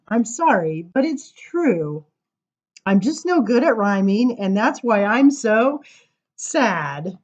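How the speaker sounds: lively sing-song verse reading; poem reading. Acted. Reading a funny poem to the crowd, sing-song. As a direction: bounce through the rhymes for listeners. Reads rhyming poem aloud.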